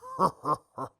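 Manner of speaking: short gloating chuckle; mocking laugh. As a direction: laugh at her misfortune. Mocks humiliated cellmate.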